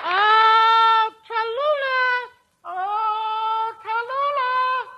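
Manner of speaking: long, high drawn-out call for the dog. Acted. [name] calling the lost dog into the house, drawn out and high. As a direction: call into the dark house, stretching the name.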